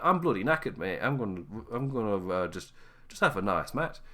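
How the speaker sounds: lightly put-on northern English accent. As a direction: quote him in a mild northern accent. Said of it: "why I did that northern accent".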